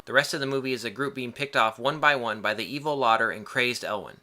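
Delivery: ordinary talk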